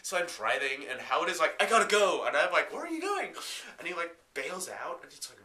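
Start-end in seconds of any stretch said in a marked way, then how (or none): 1.6-2.2 Howard's hurried, louder cry before he bails out; urgent mock yell. Howard yelling to his friend before jumping out. 2.7-3.4 much higher, startled question; shocked squeak. Retold or imitated. Narrator shrieking at Howard as he bails out.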